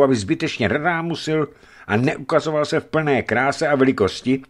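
ordinary delivery, nothing marked

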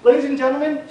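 loud, high host introduction calling out to the crowd; announcer shout. Real, not acted. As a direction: rev up the room like an MC. Host introducing speaker to audience.

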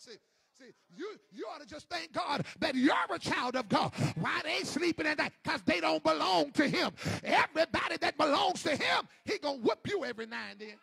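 loud hammering sermon delivery; preaching at full force. Acted. Preacher to congregation, full-force shouted preaching.

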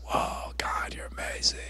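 very soft, whispered wonder; awed whisper. Retold or imitated. Worshipper glued to the floor by God, awed whisper. Awed reaction to God's presence.

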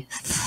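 th with tongue on upper teeth, blowing air out; th sound. Shown as a demonstration. Teacher showing students the th sound, breathy demo. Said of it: "Es poniendo como la lengua hacia arriba".

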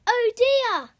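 high, loud oh dear; a storyteller's big dismay at the stolen treat; dismayed cry. Acted. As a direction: gasp it out in dismay. Seagull stole the ice cream.

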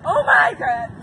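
being chased. Real, not acted.

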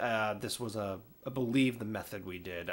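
usual speaking voice

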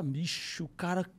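normal talking voice

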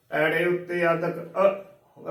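ordinary delivery

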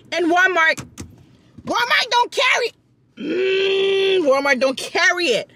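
high, indignant complaint; exasperated. Real, not acted. Vlogger venting that Walmart lacks her cheese, exasperated and high. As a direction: pitch it up in real outrage. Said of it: "I'm just so bothered".

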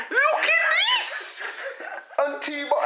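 laughing through the words; cracking up mid-sentence. Real, not acted. Laughs at friend's big pour.

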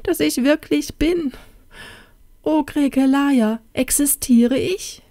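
very high, plaintive lament; tearful cry. A narrator's reading. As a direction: plead in a high tearful princess voice. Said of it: "rief sie unter Tränen". (hohe, weinerliche Klage)

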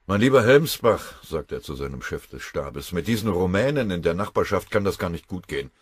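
the general sounding off to his staff; commanding general. Acted. General in a bad mood, speaking loudly to his chief of staff.

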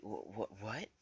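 stuttered disbelief rising to a question; mock-stunned stuttering; shocked stammer. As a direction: stumble twice, then get the word out. Acted shocked stammer at a reveal.

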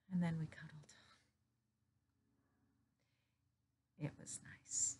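soft, breath-heavy near-whisper; sigh, then confide it very softly; hushed confession. Acted. Recalling a cuddle with a dead friend, hushed. Tender end of the retold dream.

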